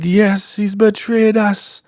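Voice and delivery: very high, slow mock zealot; echoing follower. Follower repeating the leader's accusation, high slow zealot voice. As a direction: parrot it in a high drawn-out voice. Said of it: "She's just repeating everything that the highest is saying".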